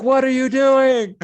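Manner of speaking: high, loud alarmed cry; high loud quote of a panicked friend. Retold or imitated. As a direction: voice her panic at you. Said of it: "she's like".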